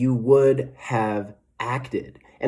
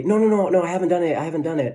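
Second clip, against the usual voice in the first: put-on much higher, pleading kid voice; whiny kid protest; 6 semitones higher, much faster than usual. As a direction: kid begging off, trailing down. Kid answering mom about chores.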